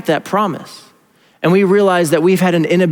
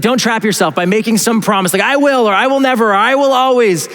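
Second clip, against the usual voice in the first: higher, quicker restating; animated paraphrase; 5 semitones higher than usual. Retold or imitated. Preacher paraphrases the Proverbs author, animated restating. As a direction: rephrase it lively, pitch climbing on the vows. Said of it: "He's like".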